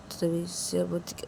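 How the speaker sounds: usual speaking voice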